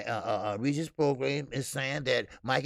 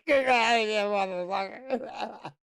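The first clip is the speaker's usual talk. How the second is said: laughing talk; 7 semitones higher than usual. Real, not acted. Laughing at viewer's joke.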